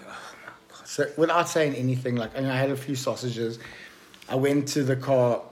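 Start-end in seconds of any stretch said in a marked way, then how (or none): 0.1-0.9 barely audible whisper to oneself; retold whispered groan of dread; whispered dismay. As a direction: breathe it out in disbelief, no voice. Retold speechless dismay, under the breath.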